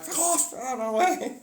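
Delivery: high, pushy shout; mock yell. Chased by the alien, yelling high to get past. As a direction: shove him aside with a high yell. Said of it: "And I'm like".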